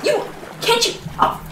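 sudden high-pitched cry to stop; frantic order. Acted. Mother to child eating the only food, frantic order. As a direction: cut them off in alarm.

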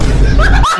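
sudden high-pitched laugh; loud burst of laughter. Real, not acted. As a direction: break out laughing loudly. Laughs at own nerdy voice.

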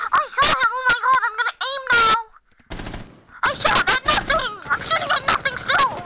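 high, excitable mock-idiot voice; hammed-up high-pitched clueless gamer; mocking player voice. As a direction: ridicule a bad player by playing them. Mocking the Doc player being spectated.

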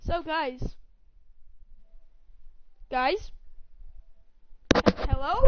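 loud calling for friends. Real, not acted. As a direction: call out across the room.

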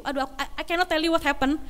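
ordinary delivery

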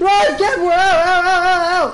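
high, frantic yelps to escape; panicked shouting. Real, not acted. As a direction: yell in a real panic to flee. Yelling to flee in game.

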